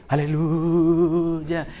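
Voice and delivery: mock the brother who prays in a put-on holy voice; pompous prayer voice. Mocking the old-style praying brother, stretched out slow. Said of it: "Muchos cambian la voz cuando oran".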